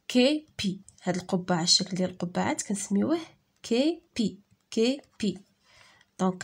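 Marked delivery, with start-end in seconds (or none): 0.1-0.8 Kepi split into two beats, ké, pi; syllable by syllable. Shown as a demonstration. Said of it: "Frappe les syllabes dans tes mains". 3.6-5.4 كيبي split into two beats, ké, pi; syllable by syllable. Shown as a demonstration. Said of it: "Frappe les syllabes dans tes mains".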